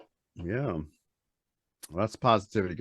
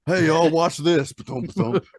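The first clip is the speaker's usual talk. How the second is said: put-on Southern accent; 7 semitones higher, 6 dB louder than usual. Man joking about running someone over, Southern accent. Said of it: "we need a southern accent".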